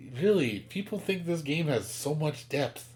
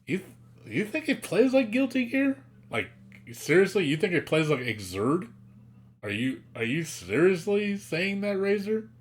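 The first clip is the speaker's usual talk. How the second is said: incredulous; 7 semitones higher than usual. Real, not acted. Disbelieving challenge to fan.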